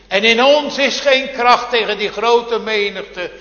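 high, loud desperate cry; king crying out as enemies storm Juda, loud and high; Jozefat's cry. Retold or imitated. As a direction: call out in distress, voice lifted high. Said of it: "riep koning Jozefat uit".

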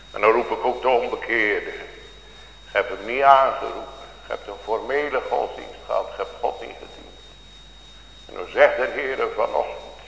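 normal talking voice

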